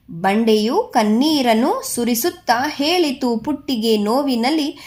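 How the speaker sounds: put-on measured, lilting poem reading for children; poem recitation. Teacher reads the Putti poem to grade six children, lilting recitation. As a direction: recite each verse line in rhythmic phrases.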